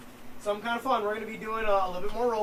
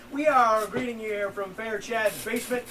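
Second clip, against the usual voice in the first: ordinary talk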